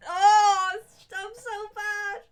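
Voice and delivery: high, drawn-out wail of embarrassment; loud groan rising into a self-mocking whine; embarrassed wail. Real, not acted. Streamer cringing at own accent attempt, embarrassed wail.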